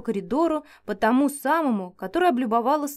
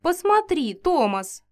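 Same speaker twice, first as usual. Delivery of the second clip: excited; 6 semitones higher, slower than usual. Acted. Giantess calls husband.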